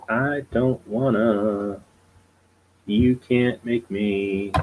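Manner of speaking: slow, drawn-out pretend sulk; hammed-up stubborn protest, very slow; mock childish refusal. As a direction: drag out each word like a stubborn kid.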